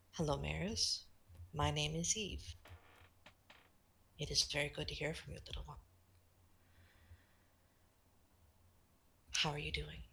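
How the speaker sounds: soft kind voice fading to a whisper; gentle whisper. Acted. Eve greeting shy Maris, gently whispered. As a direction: welcome the little one tenderly.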